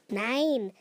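ordinary delivery

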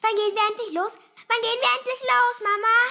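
high, loud, rushed demand; stormy childish nagging; impatient, boisterous. A narrator's reading. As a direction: pester like an impatient little boy. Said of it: "fragte er stürmisch".

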